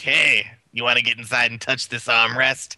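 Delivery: mock-seductive invitation in a put-on voice; hammed-up, teasing come-on, voice altered from your own; tantalizing put-on voice. Car owner luring passengers to touch the armrest, tantalizing put-on voice. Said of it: "I say it in that voice".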